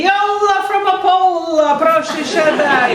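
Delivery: high, drawn-out hail; hearty greeting call. Retold or imitated. Interviewer hails a student coming in, high drawn-out greeting call. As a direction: sing out the name in a high, stretched greeting.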